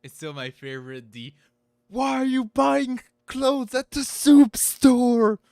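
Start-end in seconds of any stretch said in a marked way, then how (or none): 1.9-5.4 much higher, much louder, drawn-out yell; hammed-up slow yell. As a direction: bellow the meme line slowly. Quoting the meme clip.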